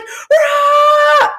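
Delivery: high, loud "rah" yell; hammed-up shriek of frustration; mock freak-out scream. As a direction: imitate somebody losing it. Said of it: "somebody freaking out".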